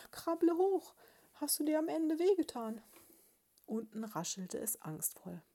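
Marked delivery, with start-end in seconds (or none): 0.1-2.8 high, louder worried squeak; piepsende Haselmausbraut. A narrator's reading. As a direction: call out in a high little mouse voice. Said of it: "piepste sie". (hohes, lautes Mäusepiepsen)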